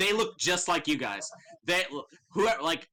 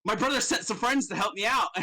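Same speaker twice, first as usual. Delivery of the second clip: Darren voiced; 7 semitones higher than usual. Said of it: "he's like".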